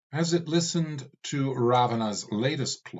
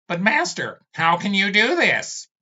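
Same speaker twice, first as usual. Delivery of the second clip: high, louder, anxious plea; pleading servant skull; 10 semitones higher, 7 dB louder than usual. Acted. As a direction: beg your master to stop.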